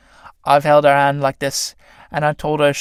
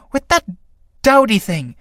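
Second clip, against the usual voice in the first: scornful; 7 semitones higher than usual. Acted. Clytie scorning Iris.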